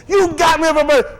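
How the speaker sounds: put-on loud, high, thrilled kid's shout; excited child. Preacher imitating a child thrilled with a birthday gift, excited shout. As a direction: shout it like a kid who finally got the gift.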